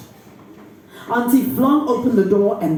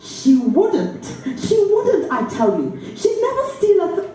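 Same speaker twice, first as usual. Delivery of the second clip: high, rushed insistence that breaks off; desperate insisting; 9 semitones higher than usual. Acted. Niece insisting to her aunt, then faltering. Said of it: "I faltered".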